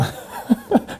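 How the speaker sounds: high breathy laugh. Real, not acted. Recalling his shock.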